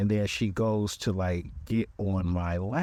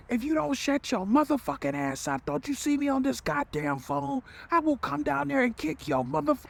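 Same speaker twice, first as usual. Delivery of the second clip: put-on screaming at the kids in a much higher pitch; furious yell. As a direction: blow up at the kids, voice cracking high. Mother yelling at kids mid-call.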